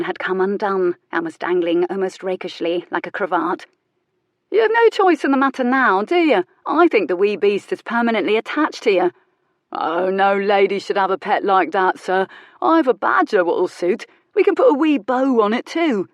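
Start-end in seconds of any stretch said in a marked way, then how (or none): 4.5-9.1 teasing workman. Acted. 9.7-16.1 joking workman. Acted.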